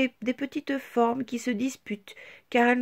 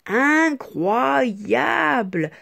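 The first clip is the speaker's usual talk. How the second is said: very slow, louder exclamation; amazed; 3 semitones higher, 7 dB louder, much slower than usual. A narrator's reading. Narrator marvels at the transformation, slow and loud.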